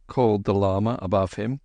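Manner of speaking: usual speaking voice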